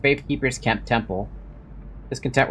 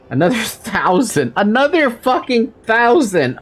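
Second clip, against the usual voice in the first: exasperated; 10 semitones higher, 5 dB louder than usual. Real, not acted. Still needs another thousand.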